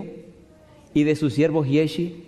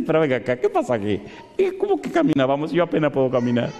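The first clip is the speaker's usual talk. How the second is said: a hint of a worn-out old man objecting; lightly voiced old Moses, incredulous; old Moses protesting; faster than usual. Preacher voices tired old Moses. (un toque de anciano cansado que protesta)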